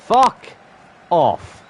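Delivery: loud, high curse broken in two; real exasperated shout; angry disbelief. Real, not acted. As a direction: burst out, pausing between the words. Reacting to a bad miss.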